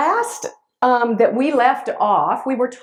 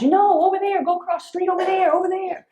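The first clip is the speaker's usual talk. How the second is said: high, pressing inner nudge; a hint of a pleading inner voice; urgent inner voice; 9 semitones higher than usual. Inner voice urging her on.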